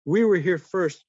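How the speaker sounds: mock indignant claim of being first; retold protest. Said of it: "She, she, she said".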